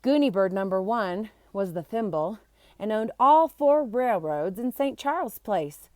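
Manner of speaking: plain reading voice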